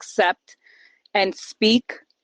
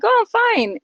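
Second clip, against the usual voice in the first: much higher, breezy brush-off; fake cheerful; 13 semitones higher than usual. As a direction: chirp "I'm fine" to hide the pain. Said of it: "I'm like".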